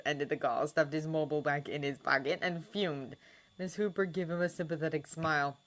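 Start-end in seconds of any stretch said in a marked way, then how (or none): none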